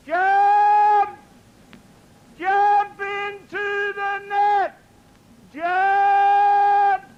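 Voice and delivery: high, slow shouts of jump up at the building; firemen shouting. Acted. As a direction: yell it up to a man twenty storeys high.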